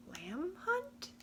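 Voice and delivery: puzzled echo. Acted.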